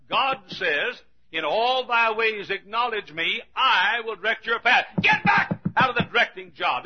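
emphatic pulpit exhortation; forceful preaching. Real, not acted. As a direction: drive each point home to the congregation.

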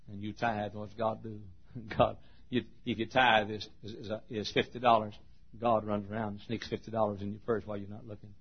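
ordinary delivery

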